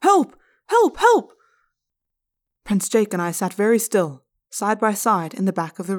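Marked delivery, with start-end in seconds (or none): 0.0-1.3 loud high desperate shouts; shouting for help. A narrator's reading. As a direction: yell for help running out. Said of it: "began to shout".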